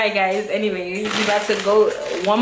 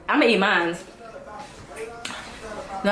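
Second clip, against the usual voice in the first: ordinary talk, nothing marked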